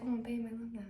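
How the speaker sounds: soft, swooning mock-girlfriend murmur; mock lovey girlfriend. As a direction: coo it softly, making fun of her. Voices gushing girlfriend in retelling.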